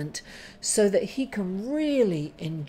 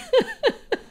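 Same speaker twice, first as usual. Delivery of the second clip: a quick, high burst of laughter; real high laugh; 3 dB louder than usual. Real, not acted. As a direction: laugh out loud at the memory. Laughs about her mum.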